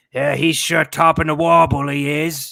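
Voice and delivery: loud, hammed-up British accent; over-the-top British geezer; fake British slang. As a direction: belt out mock British slang. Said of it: "just fake British slang".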